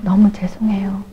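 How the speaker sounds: imitating a breathy, voiceless "너무 죄송해요"; whispered apology. Elder apologizing to the evangelist, whispered. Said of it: "너무 죄송하다고".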